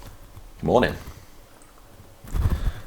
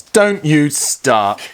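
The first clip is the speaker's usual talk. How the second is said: mock warning; 7 semitones higher, 4 dB louder, much slower than usual. Teasing warning to partner.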